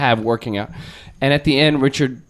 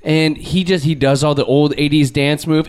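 normal talking voice

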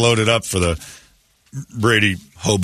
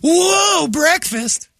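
mock surprise; much slower than usual.

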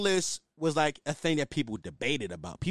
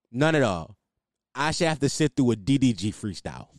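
ordinary talk

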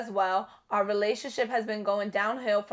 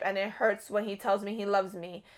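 ordinary delivery

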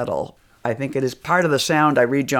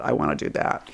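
whispered thought; 10 semitones lower, 5 dB quieter than usual. Retold or imitated. Retelling a private thought.

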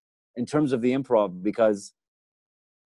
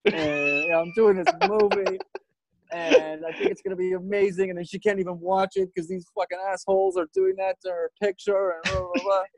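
near-tearful retelling; 7 semitones higher than usual. Retold or imitated. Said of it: "almost in tears in my trailer".

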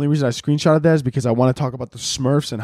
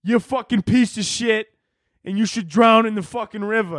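mock Chicago hot dog purist; 7 semitones higher than usual. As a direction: ham up an irate Chicagoan, pitched higher. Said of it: "They're like".

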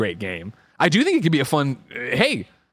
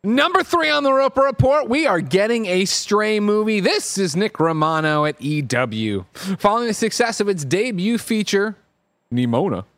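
high, punchy headline read; news read; 7 semitones higher, slower than usual. Acted. Host opens a news report for listeners, punchy announcer read. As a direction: announce the headline with drawn-out flourish.

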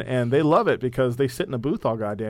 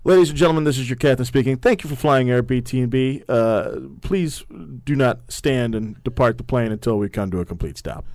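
pilot on the intercom, louder; like a captain speaking to passengers; airline captain announcement; 4 dB louder than usual. Pretend. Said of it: "All right, here we go. Round two. Ready?".

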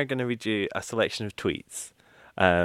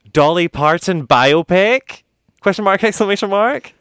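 reading in all caps; 9 semitones higher, 9 dB louder than usual. Shown as a demonstration. Reading out his own all-caps tweet, loud. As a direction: shout it like all-caps text. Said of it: "All caps".

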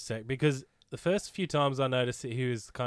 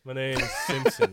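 mock Homer Simpson line. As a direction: toss off a silly Homer impression. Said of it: "Could have been Homer Simpson".

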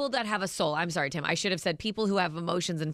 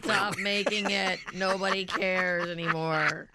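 ordinary talk

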